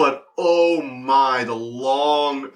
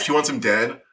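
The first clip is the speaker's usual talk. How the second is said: ordinary talk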